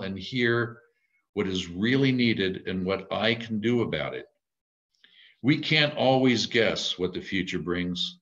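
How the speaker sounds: ordinary delivery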